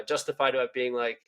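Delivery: ordinary delivery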